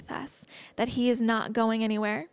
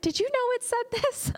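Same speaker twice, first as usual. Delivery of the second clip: much higher, squealing in amazement; amazed disbelief; 13 semitones higher than usual. Retold or imitated. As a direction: re-enact the shock of reading it. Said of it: "I read this and I went".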